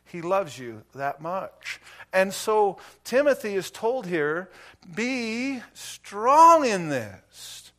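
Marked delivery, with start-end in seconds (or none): 4.9-7.7 each word drawn out very slowly, higher and louder; slow emphatic quote. Acted. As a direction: lay weight on every word. Preacher quoting Timothy emphatically.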